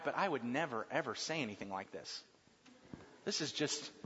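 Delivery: shy man admitting he'd never say it; embarrassed aside. Retold or imitated. Man reading a greeting card for his wife, quiet and embarrassed. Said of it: "you read these cards and you say".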